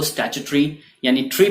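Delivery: स्टैट्यूटरी ending in ट्री, "tree"; British "STATCH-tree", then the bare ending ट्री; UK pronunciation. Shown as a demonstration. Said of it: "UK में इसको".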